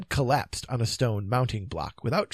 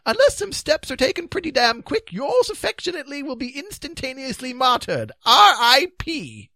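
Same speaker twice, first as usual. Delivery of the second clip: firm high warning, spelling out the last letters heavily; Sir Palomides; 8 dB louder than usual. A narrator's reading. Knight warning the king of his doom, high and definite. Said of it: "said Sir Palomides definitely".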